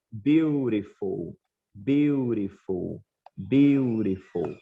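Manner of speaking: beautiful, each syllable drawn out slowly with an R-like tap; slow teacher model of "beautiful"; slow drilled pronunciation. Shown as a demonstration. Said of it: "Falem aí na casa de vocês". (pronúncia lenta e pausada de "beautiful")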